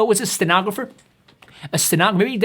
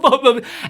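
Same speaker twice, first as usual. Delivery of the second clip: mock guffaw; 7 semitones higher, 3 dB louder than usual. Mock audience laughing.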